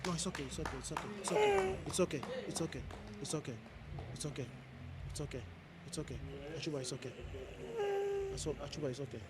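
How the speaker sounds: soft, quiet soothing off to the side; calm someone down gently; murmured comfort to a tearful listener; reassuring. Real, not acted.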